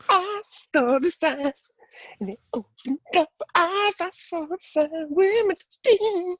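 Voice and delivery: silly voice. Shown as a demonstration. Showing cohost a silly voice, very high and slow. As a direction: squeak the song out slowly. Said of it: "the only voices I do".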